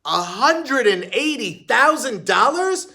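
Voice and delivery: high, loud, slow astonished question; stunned disbelief. A narrator's reading. Heidi hearing the dog's price, astonished, high and loud.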